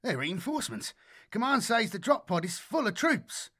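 high, squealing radio voice; squealing excited. Acted. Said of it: "squealing squall of static".

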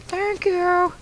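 very high squeaky falsetto thanks; Freddy. Acted. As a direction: put on a tiny character voice to accept praise. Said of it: "I'm gonna keep doing the voice".

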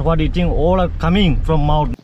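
ordinary talk

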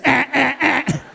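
short, high bursts of noise; imitated jet noise. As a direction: make sharp repeated squirting sounds. Imitates the bidet jet tracking.

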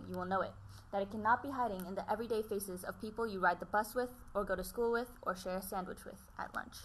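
soft, even reading of a book passage; hushed storytelling read; reading aloud. Acted. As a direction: read it to us quietly, like a letter.

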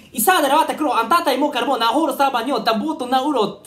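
loud, high made-up foreign babble; mock gibberish. Player bursting into loud made-up gibberish. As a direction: rattle off nonsense like a foreign anthem.

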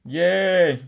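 loud high happy yay; excited cheer. Real, not acted. Cheering about day off.

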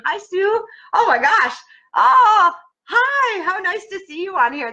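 high, loud excited hello; delighted greeting. Real, not acted. Host spots her childhood friend Sue in the chat, high delighted hello.

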